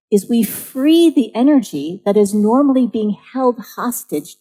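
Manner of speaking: ordinary talk, nothing marked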